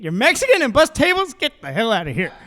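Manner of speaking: high, loud mock disbelief. Comic voicing the audience's imagined sarcastic disbelief. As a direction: act out the audience's fake shock. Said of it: "I know what you guys are thinking".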